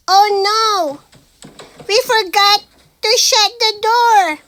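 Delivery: high, loud alarm; frightened shout; panicked cry. A narrator's reading. Forgot the door, bear chasing.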